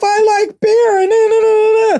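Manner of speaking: high-pitched mocking blubber; blubbering Brett Kavanaugh. Podcaster mocking the blubbering they watched him do, high hammed-up blubber. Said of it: "do that blubbering stuff".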